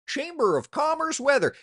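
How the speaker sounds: high, loud mocking quote of a disliked phrase. Quoting a weather cliché he hates, mocking and loud. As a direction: sneer at the cliché. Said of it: "It's like".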